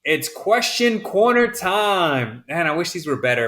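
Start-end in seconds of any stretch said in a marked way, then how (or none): 0.0-2.5 drawn-out, higher game-show style intro; hammed-up, very slow announcement; segment announcer. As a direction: stretch out the segment title playfully.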